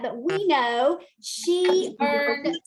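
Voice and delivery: slow, loud proud reveal; announcing big news. Real, not acted. Revealing teammate's award.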